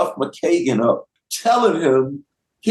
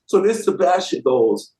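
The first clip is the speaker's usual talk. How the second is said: usual speaking voice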